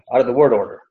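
ordinary talk

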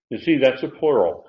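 ordinary delivery

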